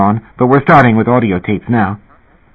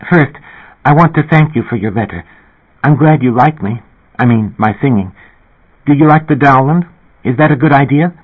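higher, fast, eager and timid; excited singer thanking a fan, higher and fast; rushing, excited; 6 semitones higher than usual. Acted. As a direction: rush the words out, panting a little. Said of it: "a rushing, excited voice".

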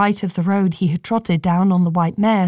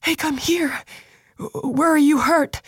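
put-on young man voice, urgent; 8 semitones higher than usual. Thalen's voice, soft and urgent.

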